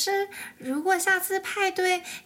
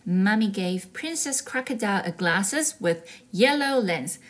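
story narration; 4 semitones lower than usual. A narrator's reading.

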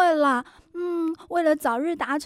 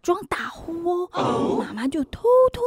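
hushed whisper. Acted. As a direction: whisper so mom won't hear. Sneaking in bed, faking sleep.

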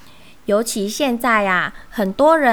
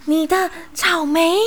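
out of breath; 6 semitones higher than usual. A narrator's reading. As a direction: gasp it out after running to catch up. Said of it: "气喘吁吁地说".

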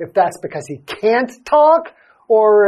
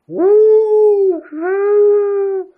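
high, loud wind howl; 13 semitones higher, 4 dB louder than usual. Shown as a demonstration. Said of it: "I'm guessing it makes, like".

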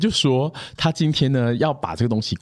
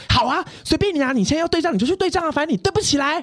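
higher, sulky retort, hammed up; mock angry client. Client snapping at her over the phone, mock angry. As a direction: toss it back like a sulking client. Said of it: "他就很生气".

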